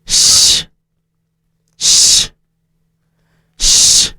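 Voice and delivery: "Sh, sh, sh" three voiceless hushes with pauses; sh sound. Shown as a demonstration. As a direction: breathe out "sh" three times, no voice. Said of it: "So listen".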